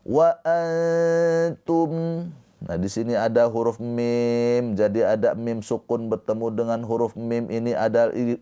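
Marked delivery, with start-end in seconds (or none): 0.0-2.3 high, slow antum with n left hidden in a long hum; veiled nun. Shown as a demonstration. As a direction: hold antum's nasal without touching the t yet. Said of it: "tapi jangan ditempelin". (antum dengan nun samar, lidah belum menempel)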